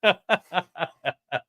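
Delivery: real laugh at own joke; cracking up. Real, not acted. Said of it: "Make myself laugh".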